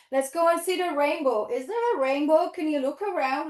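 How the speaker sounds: usual speaking voice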